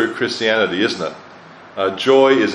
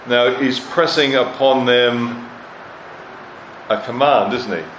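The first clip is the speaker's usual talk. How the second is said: usual speaking voice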